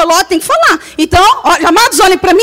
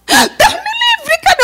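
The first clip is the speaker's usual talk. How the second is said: much higher, slow, horrified; shocked gasp; slower than usual. Retold or imitated. Coworker shocked at being called pregnant. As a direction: recoil in mock shock.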